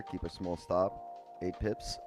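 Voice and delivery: ordinary talk, nothing marked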